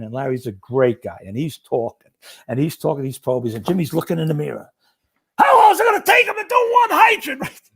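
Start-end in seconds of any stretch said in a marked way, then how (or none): 5.4-7.6 high, loud mock exasperation; exasperated outburst. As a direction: explode with impatience, pitched way up. Driver fed up watching slow probies.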